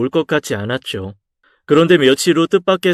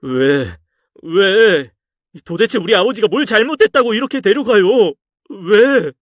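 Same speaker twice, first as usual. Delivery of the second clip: screaming at God; 8 semitones higher than usual. Acted. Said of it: "목이 터져라 신을 원망했습니다".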